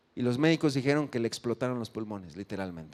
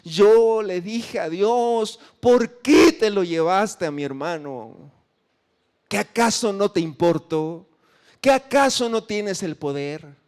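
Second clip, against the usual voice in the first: higher, louder, slow anguished questioning; grieving plea; 4 semitones higher, 5 dB louder than usual. Retold or imitated. Grieving brother reproaching God over his death, anguished. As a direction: let the grief break through the question. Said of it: "el hermano estaba llorando".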